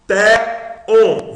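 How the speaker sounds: TEON high and loud, weight on the ON; stronger final syllable. Shown as a demonstration. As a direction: punch the ON of TEON. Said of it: "você lê on mais forte".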